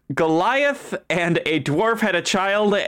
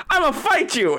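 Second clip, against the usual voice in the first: eager challenge; 10 semitones higher than usual. Retold or imitated. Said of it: "and he goes".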